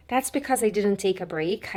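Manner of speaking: ordinary talk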